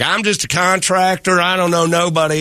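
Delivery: high hillbilly twang; put-on hillbilly accent. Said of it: "why did I use hillbilly voice?".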